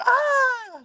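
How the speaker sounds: short high-pitched shriek of fright; retold scream. Startled guest caught by the monster, shrieking high. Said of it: "She screams".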